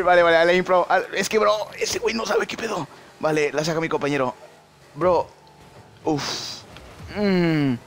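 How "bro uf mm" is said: usual speaking voice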